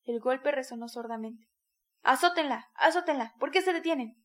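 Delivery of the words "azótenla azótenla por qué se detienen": louder, urging yell; crowd shout. A narrator's reading. Someone in the crowd urging the whipping, loud yell. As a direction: egg them on with a yell. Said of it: "gritaron entre el grupo".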